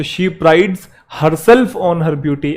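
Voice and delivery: slow, deliberate correction; slow dictation. Shown as a demonstration. Teacher reading the corrected sentence slowly. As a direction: read the fix out slowly. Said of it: "dictating corrected sentence".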